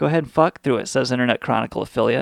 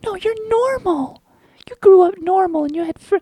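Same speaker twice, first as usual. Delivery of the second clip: mock reassuring friend. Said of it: "they're like".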